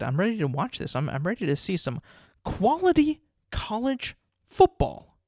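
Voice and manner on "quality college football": stretched high, slow mock-excited drawl; mock hype. Fan mocking his own bowl-game hype: stretched high. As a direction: oversell it like a giddy fan. Hyped self-quote about bowl games.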